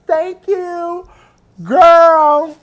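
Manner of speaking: high, drawn-out thanks; sing-out falsetto gratitude, stretched at the end; imitated grateful star. Singer answers fan's compliment, high gushing thanks.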